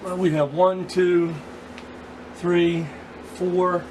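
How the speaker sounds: usual speaking voice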